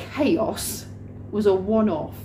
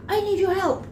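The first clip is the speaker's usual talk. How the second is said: high mock cry for help; hammed-up, high-pitched plea; panicked boss; 10 semitones higher than usual. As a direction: mimic him calling urgently down a hallway. Rob calling down the hallway.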